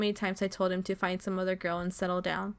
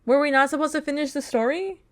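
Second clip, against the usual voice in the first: loud, high-pitched questioning; baffled protest; 6 semitones higher, 7 dB louder than usual. Real, not acted. Gamer confused that the story ended with no other options, baffled protest. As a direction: protest in disbelief, voice climbing on the questions.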